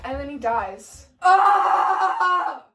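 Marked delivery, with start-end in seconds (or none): 1.2-2.7 long, loud death scream; hammed-up drawn-out death yell; dying scream. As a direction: scream out the character's last breath. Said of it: "he lets out a scream".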